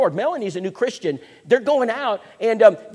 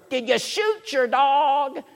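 gruff neighbour; 7 semitones higher, much slower than usual. Retold or imitated. Grumpy neighbour at door.

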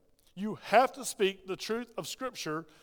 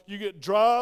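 ordinary talk, nothing marked

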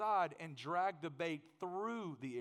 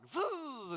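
casting whizz; 10 semitones higher, 4 dB louder than usual. Retold or imitated. Imitating the casting line whizz.

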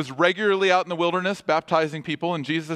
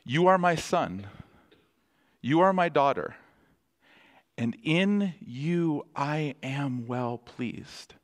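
slow, tender blessing, the last line drawn out; God's blessing; slower than usual. Retold or imitated. Pastor speaking God's words over the church, slow and tender. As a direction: speak it gently over them like a father. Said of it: "He speaks over us".

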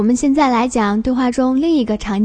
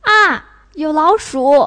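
higher, slow alarmed exclamation; startled; 4 semitones higher, 4 dB louder, slower than usual. Shown as a demonstration. Teacher showing fourth tone with a mouse, startled. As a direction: cry out at a sudden sight. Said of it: "它表示惊讶".

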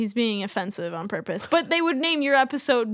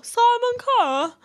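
higher, drawn-out celebrity imitation; mock TV judge, pitched up; Simon Cowell; 12 semitones higher, 4 dB louder, slower than usual. Teasing the co-host's calm-voice author, mock celebrity impression. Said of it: "Sim-, Simon Cowell".

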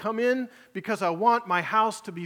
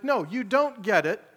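forceful preaching; 3 semitones higher than usual. Acted. Preacher voicing Jesus to congregation, forceful preaching. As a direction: hammer it home as Jesus, urgent.

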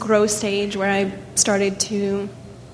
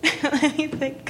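holding back tears, laughing nervously; trying not to cry; almost crying; 6 semitones higher, 3 dB quieter than usual. Real, not acted. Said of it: "You wouldn't be almost crying".

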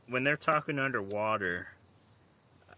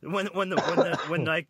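laughing; 9 semitones higher, 4 dB louder than usual. Real, not acted. Laughing through his words.

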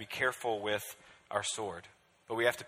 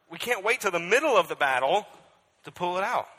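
loud, higher sermon voice; full-force preaching; 8 semitones higher, 12 dB louder than usual. Acted. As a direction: call the church to arms.

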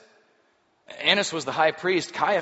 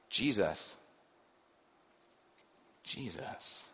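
hushed awe; 3 semitones lower, 8 dB quieter, much slower than usual. Acted. Healed leper names healer.

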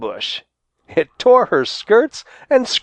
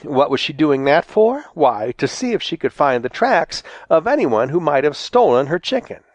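plain reading voice

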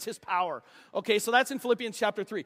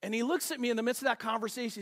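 ordinary delivery

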